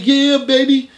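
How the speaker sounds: high, loud, laughing hype-man greeting; loud fake-friendly greeting. To the armed man, overjoyed fake-friend shout. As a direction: greet him like a long-lost buddy, way up high. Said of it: "I was in Jeff mode".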